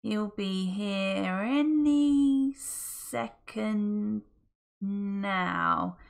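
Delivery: slow, doubtful stretch. Acted. Friday still waiting for the rainbow, slow and unsure. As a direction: drag it out, losing faith. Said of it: "a little less confidently this time".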